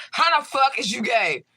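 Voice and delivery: high, slow disbelief; incredulous. Real, not acted. Ranting at her ex, high and slowly incredulous. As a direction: drag it out in shocked disbelief.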